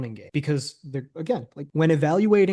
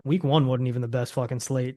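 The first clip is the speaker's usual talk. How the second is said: ordinary talk, nothing marked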